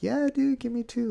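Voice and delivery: eager. Retold or imitated. Eager request for more.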